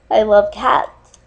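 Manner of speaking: normal talking voice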